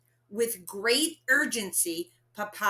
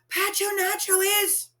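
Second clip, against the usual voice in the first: urgent panic; 12 semitones higher than usual. Acted. Panicked child trying to tell.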